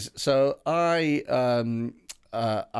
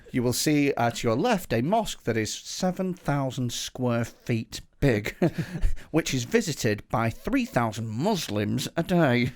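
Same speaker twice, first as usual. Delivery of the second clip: slow, measured guide commentary; factual tour guide; 2 semitones lower, much slower than usual. Acted. Biased guide pointing out a mosque to tourists, factual tour-guide voice. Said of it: "traditionally factual bus tour, but the bloke has".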